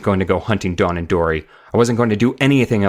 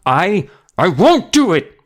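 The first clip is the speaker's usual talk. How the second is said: slow, much higher and louder stammered scream; 11 semitones higher, 6 dB louder, slower than usual. A narrator's reading. Billy screaming at Uncle Al, slow and loud. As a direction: scream it out, defiant and scared. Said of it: "I screamed as loudly as I could".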